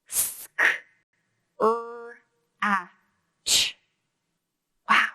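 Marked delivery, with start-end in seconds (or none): 0.1-3.8 Sk-, er, a, ch said one at a time; sounds pulled apart. Shown as a demonstration. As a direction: segment scratch sound by sound. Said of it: "Let's pull that word apart".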